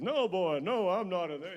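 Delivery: put-on high, playful voice telling the kid he isn't in there; fake reassurance. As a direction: hum it up high, teasing the little kid. Man fooling a kid at hide-and-seek.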